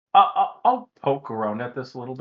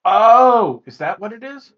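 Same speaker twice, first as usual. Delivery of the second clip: real surprise, pitched well up; surprised realisation; 11 semitones higher, 9 dB louder, slower than usual. Real, not acted. Sudden realisation at player's explanation.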